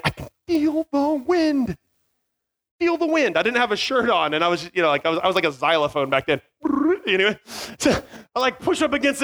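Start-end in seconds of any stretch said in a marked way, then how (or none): 0.0-3.4 awed disbelief. Retold or imitated. Feeling the donkey's kick whoosh past, stunned. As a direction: relive the near miss in awe.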